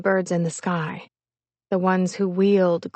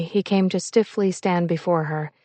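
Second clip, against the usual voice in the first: plain reading voice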